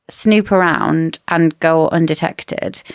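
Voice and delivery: normal talking voice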